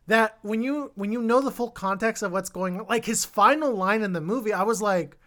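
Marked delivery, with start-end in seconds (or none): none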